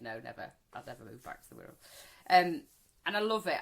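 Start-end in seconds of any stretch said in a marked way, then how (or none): 0.0-1.7 lower, quick flat no; answering a friend about moving back; firm refusal. Retold or imitated. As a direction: dismiss the idea outright. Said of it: "I'm like".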